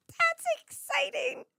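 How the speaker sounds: high, drawn-out excited squeal; excited outburst. Real, not acted. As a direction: burst out in a giddy, high-pitched voice. Bursts out excited, then apologises.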